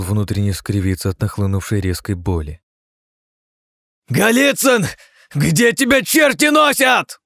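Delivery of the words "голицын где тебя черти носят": furious high bellow; raging shout. A narrator's reading. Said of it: "продолжал свирепствовать цесаревич".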